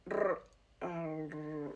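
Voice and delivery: English R, tongue curled back; R sound demo. Shown as a demonstration. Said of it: "and you make the R sound".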